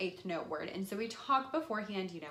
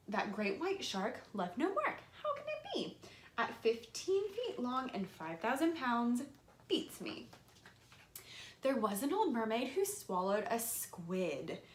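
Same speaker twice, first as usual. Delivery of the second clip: put-on reading to children; 2 semitones higher, slower than usual. Reads picture book aloud.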